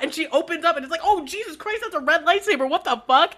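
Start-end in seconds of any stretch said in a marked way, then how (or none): 1.0-3.3 higher-pitched comic alarm; mock startled. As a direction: blurt it out as if startled. Voices Rey startled by a lightsaber.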